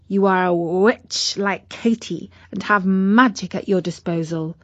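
ordinary delivery, nothing marked

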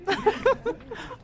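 short shy high-pitched giggle. Real, not acted. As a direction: giggle bashfully after singing. Embarrassed after singing for colleagues.

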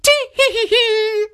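tittering high-pitched cackle; high old woman giggle. Acted. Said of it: "still laughing".

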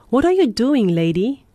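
sharp and loud, pitch jumping up; angry. A narrator's reading. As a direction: bark it out in protest. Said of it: "the man asked angrily".

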